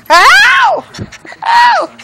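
high, loud yelps of pain. Real, not acted. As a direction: yelp out as it bites. Dog biting her.

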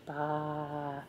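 breathy sheep bleat, barely voiced; whispered sheep bleat. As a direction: whisper a little baa to the baby. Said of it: "That's a sheep".

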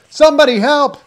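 very high, very loud yell. A narrator's reading. Nick yells for help to the empty wharf, yelling. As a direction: yell for help with everything you have. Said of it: "he yelled".

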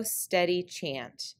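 ordinary delivery

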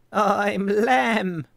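the lamb introduces himself to Tadpole, high and loud. Acted. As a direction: chirp it out like a bouncy lamb. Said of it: "said the woolly-faced creature".

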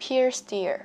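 "Pierced ear" said as one joined word; linked sounds. Shown as a demonstration. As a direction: slide the end of "Pierced" into "ear". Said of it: "Nối âm ở đây nhá". ("Pierced ear" đọc nối liền)

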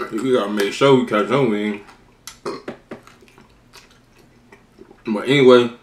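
talking while chewing wing; eating between words; mouth full of chicken. Real, not acted. Seen 2: Wing held at lips, biting and gnawing during first half of words.

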